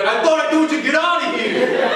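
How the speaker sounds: retold yell, cracking up through it; angry yell. Crew guy chasing them through the boiler room, laughing yell. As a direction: shout it high while laughing.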